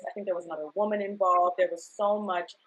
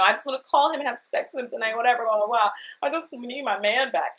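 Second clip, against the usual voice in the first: pleading quote; 5 semitones higher, 5 dB louder than usual. Retold or imitated. Quoting her heartbroken self, fast.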